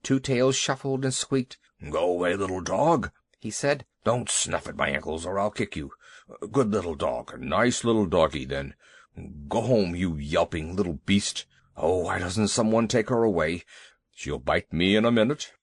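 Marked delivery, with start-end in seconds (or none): 1.9-3.1 lightly voiced big nervous elephant, a bit lower; a fretful giant shooing a dog, gently lowered. A narrator's reading. Elephant Two Tails pleads. 4.0-15.5 lightly voiced big nervous elephant, a bit lower; a fretful giant shooing a dog, gently lowered. A narrator's reading. Elephant Two Tails frightened by dog.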